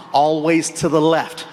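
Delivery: ordinary talk, nothing marked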